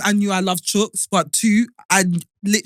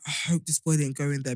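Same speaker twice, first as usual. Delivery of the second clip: worried murmur; 5 semitones lower, 9 dB quieter than usual. Retold or imitated. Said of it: "I was like".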